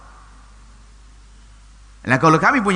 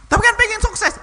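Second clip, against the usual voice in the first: much higher, squeaky imitated protest; mock objection; 4 dB louder than usual. Preacher mimics a parent insisting on success, high-pitched. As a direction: pipe up like a naive parent objecting.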